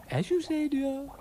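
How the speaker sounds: high, fluting lady voice; high-pitched airy acquiescence; obliging lady character. Acted. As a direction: concede in a high singsong. Ermyntrude gives way.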